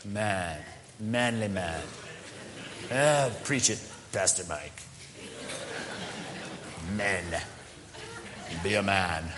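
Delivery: low mock macho cheering; lightly voiced swaggering men, low; macho guys egging on. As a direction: play the eager tough guys in the pews. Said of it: "They're going".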